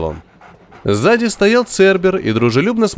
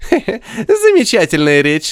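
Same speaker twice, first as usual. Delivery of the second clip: high, sneering mockery; mocking; 8 semitones higher than usual. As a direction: taunt him with a snicker. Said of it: "сказал он с издёвкой".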